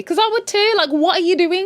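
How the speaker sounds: high, disbelieving rant; incredulous what are you doing; exasperated aside. Real, not acted. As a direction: throw up your hands at the screen.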